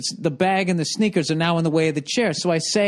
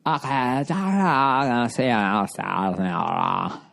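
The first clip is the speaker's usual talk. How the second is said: slow, lower drawled mock accent; 4 semitones lower, slower than usual. Mocking the nurse's apology, slow drawled accent. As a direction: drag the apology out in her accent. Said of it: "that accent".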